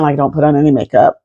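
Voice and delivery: usual speaking voice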